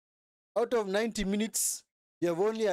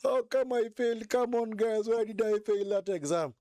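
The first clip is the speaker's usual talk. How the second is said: a hint of a whiny student, pitched up a little; mock failing student; 4 semitones higher than usual.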